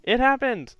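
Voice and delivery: loud happy repeat; excited. Real, not acted. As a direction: cheer it again, still thrilled.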